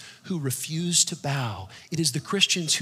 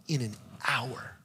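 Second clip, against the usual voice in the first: 1 whispered; 6 dB quieter than usual. Acted. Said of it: "a paper tiger".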